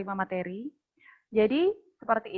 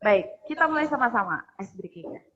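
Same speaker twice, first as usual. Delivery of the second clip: ordinary talk, nothing marked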